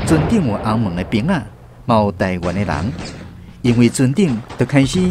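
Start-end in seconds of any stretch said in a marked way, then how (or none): none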